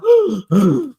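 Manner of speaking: loud exaggerated wheezing gasps; mock hard breathing. Streamer imitating rival walking round her house, mock wheezing. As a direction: imitate heavy, laboured breathing. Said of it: "be breathing like a".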